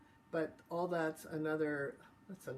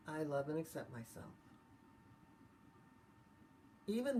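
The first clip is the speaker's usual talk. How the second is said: tapping affirmation; 2 semitones higher than usual. Acted. Leads EFT setup phrases.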